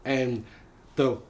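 ordinary delivery

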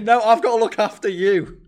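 loud, high protest; insistent shout. Retold or imitated. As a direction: insist on caring for them. Battling over who helps whom.

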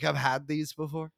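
put-on lightly done Boston accent, low and soft; a hint of a Boston guy in an ad. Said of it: "you do the Boston accent".

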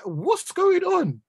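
high, slow disbelief; baffled. Real, not acted. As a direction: drag it out, stunned. Baffled at the signing.

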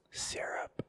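soft, voiceless whisper close to the mic; ASMR whisper. Pretend. Teasing his co-host with creepy ASMR, whispered. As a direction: whisper it right into the listener's ear. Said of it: "I'll get a little A S M R in your ear".

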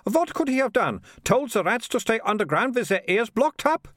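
very high, carrying voice; high-voiced loud mocking question. A narrator's reading. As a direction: pipe up for all to hear. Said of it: "said Militia loudly".

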